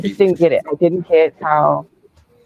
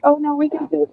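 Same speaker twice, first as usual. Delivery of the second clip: much higher, light and coaxing; mock friends' voices; 10 semitones higher than usual. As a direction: play the friends urging her on. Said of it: "we were like".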